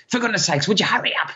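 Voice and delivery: high-pitched exasperated hurrying; frantic nagging parent. Acted. Rushed parent chivvying kids who are running late, exasperated.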